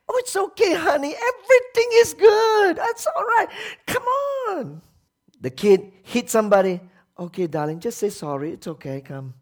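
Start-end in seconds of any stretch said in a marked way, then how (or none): 0.1-4.8 high, mock-sweet coddling mother; cooing parent. 7.2-9.3 soft, lower mock-soothing parent; coaxing parent. Parent soothing child who hit someone.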